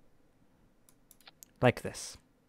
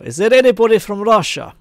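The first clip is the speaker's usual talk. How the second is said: loud, high, hammed-up Russian accent; horrible Russian accent; 9 semitones higher, 7 dB louder than usual. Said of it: "That was a horrible accent".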